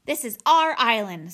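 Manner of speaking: loud, territorial snarl; forceful angry bear threat. A narrator's reading. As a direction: snarl it to claim your ground. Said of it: "snarled Nettle".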